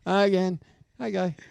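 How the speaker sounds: ordinary talk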